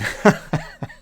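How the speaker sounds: breathy little chuckle; amused, mostly breath laugh; short laugh. Real, not acted. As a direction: laugh briefly at what was just read. Laughs at the quoted remark.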